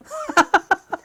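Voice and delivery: squealing laugh at his own joke; high burst of laughter. Real, not acted. Laughing at his own punchline, high squeal of a laugh.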